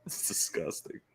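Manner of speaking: breathy whispered disgust; whispered aside. Real, not acted. Streamer muttering about the toilet shower, whispered. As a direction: hush it under your breath.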